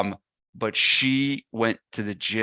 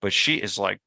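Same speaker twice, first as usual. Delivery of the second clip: ordinary delivery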